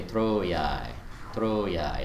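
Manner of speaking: "Troiae" with the stress on "tro" and "yai" still held long; a long unaccented "yai" after a stressed "tro"; accent on tro. Shown as a demonstration. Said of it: "I'm accenting tro".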